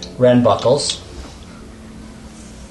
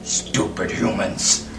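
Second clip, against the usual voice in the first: orc sneer; 5 semitones lower, much slower than usual. Acted. Orc jailer sneers at prisoners.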